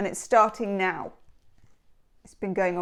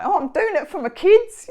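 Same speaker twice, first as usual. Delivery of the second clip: mock Australian contestant; 9 semitones higher, 7 dB louder than usual. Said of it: "a little Australian slip".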